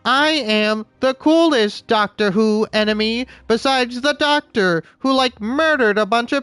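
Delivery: shrill alien robot ranting; Dalek voice. Acted. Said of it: "sound like a damn Dalek".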